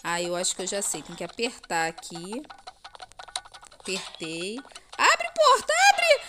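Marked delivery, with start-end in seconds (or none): 5.0-6.2 shrieking high at the door; panicked shout. Real, not acted. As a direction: yell it in a panic. Door won't open in chase.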